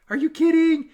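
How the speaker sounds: high, loud squeal of disbelief; excited disbelief. Retold or imitated. Coach retelling friend's reaction to the finish, squealing. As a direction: shriek it like you can't believe the news.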